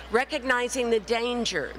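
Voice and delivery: usual speaking voice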